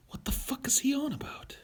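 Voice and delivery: whispered, baffled aside; mock whisper. Streamer repeating the baffled reaction to his rant, mock whisper.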